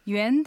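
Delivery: 元 with a rising tone; second tone. Shown as a demonstration. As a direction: draw out 元, sliding the pitch upward. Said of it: "元が二声上がります". (元を上がる二声で)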